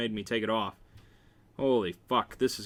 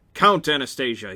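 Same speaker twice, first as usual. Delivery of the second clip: firm, louder order with weight on Count; commanding; 7 dB louder than usual. A narrator's reading. A man ordering Anastasia to count the blows, commanding. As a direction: give a loud, firm command. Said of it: "he commands".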